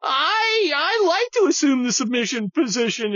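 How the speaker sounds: high, mock whiny Republican; hammed-up squeaky simpering voice; mocking moderate Republican. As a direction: parody a timid Republican. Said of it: "a bunch of Republicans out there going".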